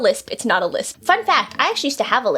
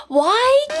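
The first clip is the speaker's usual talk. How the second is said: high exasperated wail; frustrated; 11 semitones higher, 3 dB louder than usual. Real, not acted. Student fluffing the accent, high frustrated cry. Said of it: "we're not frustrated".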